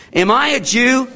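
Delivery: high-pitched, incredulous sarcasm; sarcastic. Acted. Preacher repeating Pilate's retort to show the sarcasm, mocking. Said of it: "You can just hear the sarcasm".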